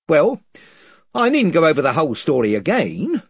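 rough, eager island chief, rambling on; a lightly voiced gossiping chief, wheedling. A narrator's reading.

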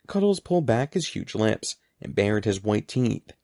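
plain reading voice

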